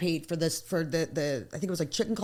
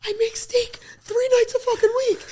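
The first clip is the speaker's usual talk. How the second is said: aghast whisper; 4 dB louder than usual. Retold or imitated. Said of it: "I was so heartbroken".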